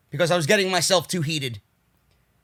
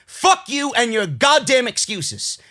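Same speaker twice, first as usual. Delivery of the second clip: ordinary delivery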